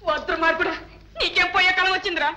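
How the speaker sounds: high, loud exasperated scolding; scolding cry. Acted. Mother scolding her son, high and loud. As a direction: shriek it at the son in dismay.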